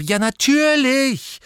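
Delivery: loud, high, slow answer. A narrator's reading. Harry answering Hermine, loud and high. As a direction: declare it loudly and staring. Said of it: "sagte Harry laut".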